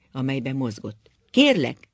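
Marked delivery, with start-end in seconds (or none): 1.3-1.9 high, loud "Kérlek" falling at the end; raised voice. A narrator's reading. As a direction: raise your voice on "Kérlek" and bring it down firmly. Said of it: "és fölemelte hangját". (felemelt hangon)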